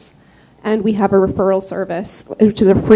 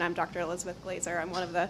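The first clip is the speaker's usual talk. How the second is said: too quiet to hear; 17 dB quieter, faster than usual. Real, not acted. Fellow introducing herself to the council, barely audible. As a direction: introduce yourself far too softly. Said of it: "Can you speak a little louder?".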